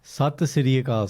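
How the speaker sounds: "Sat Sri Akal" slow, every syllable sounded out; proper pronunciation. Shown as a demonstration. Co-host correcting his partner's greeting, properly pronounced. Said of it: "say it properly".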